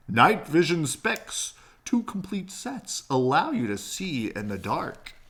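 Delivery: lightly voiced infomercial; gadget ad pitch. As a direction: pitch the specs like a TV ad. Screen ad text read aloud.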